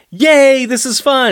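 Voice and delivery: high-pitched pretend cheer; mock glee. Voicing the look on Jack's face, mock glee. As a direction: act out a gleeful kid cheering. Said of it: "the look on Jack's face is kind of like".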